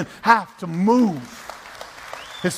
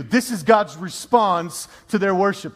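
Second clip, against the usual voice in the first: usual speaking voice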